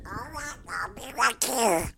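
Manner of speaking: high, soft little duck voice; Little Webster duck voice. Pretend. Radio host's duck puppet answering at the mic, high soft duck voice. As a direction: pitch it way up and quiet, like a tiny duck. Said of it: "the little duck voice I do".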